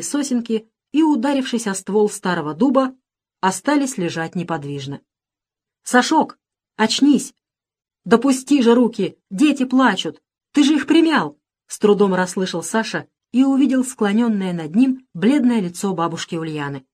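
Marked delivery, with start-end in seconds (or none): 5.8-11.4 higher, louder urgent appeal; urgent pleading. A narrator's reading. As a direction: rouse someone who is dazed. Grandmother urgently rouses dazed boy. (взволнованно, настойчиво)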